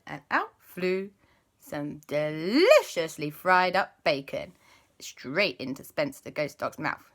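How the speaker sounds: ordinary delivery, nothing marked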